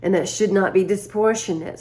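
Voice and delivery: normal talking voice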